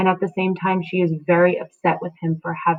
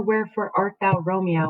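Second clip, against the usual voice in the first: usual speaking voice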